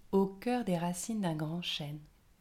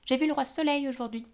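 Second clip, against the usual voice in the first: fairy Rose; 7 semitones higher, 5 dB louder, faster than usual. A narrator's reading. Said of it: "dit Rose".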